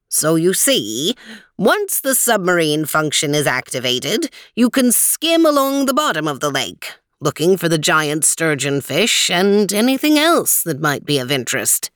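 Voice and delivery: lightly voiced old man, measured and helpful; a hint of a friendly old guide, unhurried; old man Weezer. Weezer explains feature.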